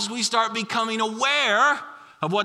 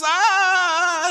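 put-on high loud little-girl ah of pain; sudden yelp of pain; child's pained cry; 11 semitones higher, 6 dB louder than usual. Dad imitating daughter's yelp, high and loud. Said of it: "And it's".

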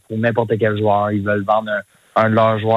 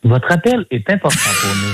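automated hold message voice; chirpy phone recording voice; customer service voice; 7 semitones higher than usual. Pretend. Joking hold message on call.

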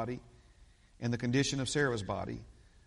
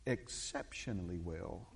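low, soft and drawn out; Hiawatha Sheffield; 7 semitones lower, 9 dB quieter, slower than usual. Retold or imitated. Imitating our dear brother's favorite response, low and slow. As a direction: savor each syllable slowly, deep and hushed. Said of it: "is how he would say it".

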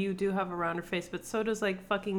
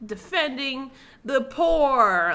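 impassioned actress; 6 semitones higher, 6 dB louder, slower than usual. Retold or imitated. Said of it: "she fell into a rhythm".

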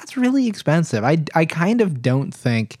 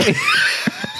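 squealing laugh. Real, not acted. Cracking up at own joke.

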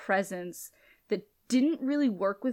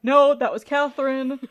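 Elena blurted loud and higher; mock hasty Elena; 5 semitones higher, 10 dB louder than usual. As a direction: rush the excuse out loud. Elena explains in a rush.

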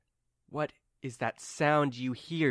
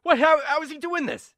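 high, loud panicked voice; freaking out; 10 dB louder, faster than usual. Acted. Limbo members see Zippy summon a bear, freaking out. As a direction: stammer in alarm, climbing to a cry. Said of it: "and they freak out".

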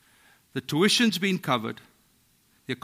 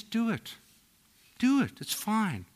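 lightly voiced, higher and unbothered; God's easy permission; 6 semitones higher than usual. God tells the self-reliant to go ahead, mildly mocking. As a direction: wave it off like God shrugging. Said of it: "God says".

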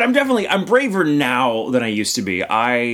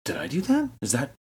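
hushed wondering; 4 semitones higher, 5 dB quieter than usual. Retold or imitated. Wondering aloud about own powers.

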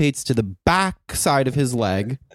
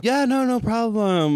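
strained fake politeness; 9 semitones higher than usual. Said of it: "my eye twitching going".

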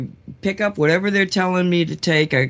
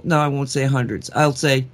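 normal talking voice

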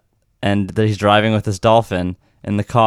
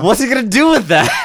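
higher, mocking incredulous rant; exasperated disbelief; 12 semitones higher, 3 dB louder than usual. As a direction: sound fed up with the movie. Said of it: "And I'm like".

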